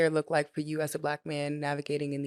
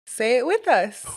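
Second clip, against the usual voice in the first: put-on high, loud kids' show host call-out; rally the little viewers, slowly and high; Dora; 7 semitones higher, 5 dB louder than usual. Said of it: "I feel like Dora".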